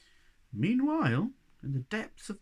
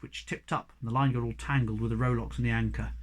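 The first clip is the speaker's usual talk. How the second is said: plain reading voice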